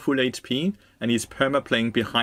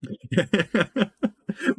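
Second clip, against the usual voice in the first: high laugh; 8 semitones higher than usual. Real, not acted. Laughing at helium voice donation.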